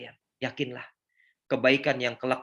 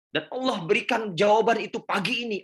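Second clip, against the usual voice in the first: higher and louder retelling of her joy; moved quoting; 10 semitones higher, 6 dB louder than usual. Retold or imitated. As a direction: voice the participant's overwhelmed thanks. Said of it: "Apa yang beliau sampaikan kepada saya". (menirukan peserta dengan haru)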